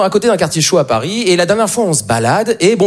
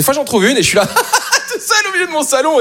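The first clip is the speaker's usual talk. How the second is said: laughing alone; 11 semitones higher than usual. Retold or imitated. Laughing alone at his own joke.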